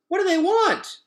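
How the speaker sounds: very high, loud squawky question; goose voice. Acted. Gosling asking Roz about robots, high squawk. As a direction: squawk it anxiously.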